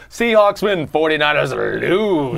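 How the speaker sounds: ordinary delivery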